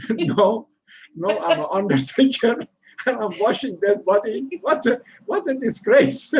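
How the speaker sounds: laughing through a mock disgraced wail; mock horror laughing. Son imagining his parents' shock, laughing mock horror. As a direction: play the shamed son, cracking up.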